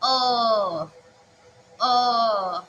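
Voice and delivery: "uh" drawn out long; teacher demonstrating a long vowel; lengthened vowel demo. Shown as a demonstration. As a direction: stretch the vowel for the class. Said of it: "the lengthened".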